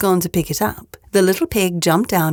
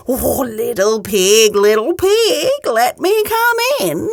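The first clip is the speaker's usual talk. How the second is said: slow, rising sing-song call; wolf coaxing; 4 semitones higher, slower than usual. Acted. Wolf at the door coaxing the pig, slow sing-song. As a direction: lure the pig out sweetly.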